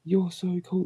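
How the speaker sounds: high, gushing fan voice; mock admirer. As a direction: swoon over him. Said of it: "they'll be like".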